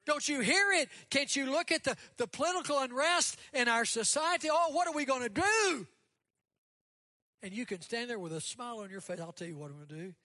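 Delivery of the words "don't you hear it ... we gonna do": loud, high-pitched hammed-up alarm; frantic mock wail, high and loud; mock panic. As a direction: imitate people panicking at the top of your voice. Mimics people panicking about the news.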